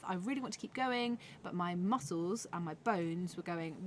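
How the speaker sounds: ordinary talk, nothing marked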